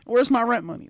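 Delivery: high, bossy rent-collector voice; a hammed-up nagging landlady; shrill nagging landlady. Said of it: "like the Gross sisters from the Proud Family".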